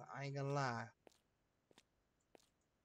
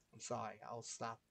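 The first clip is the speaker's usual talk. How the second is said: softer, lower I'm sorry; quiet apology; 3 semitones lower, 6 dB quieter than usual. Real, not acted. Apologizing to viewers again, now softly. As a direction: say sorry again under your breath.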